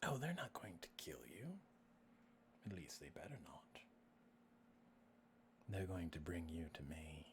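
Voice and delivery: low, whispered villain; soft, possessive menace with long pauses; creepy voice. Acted. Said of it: "you creepy voice".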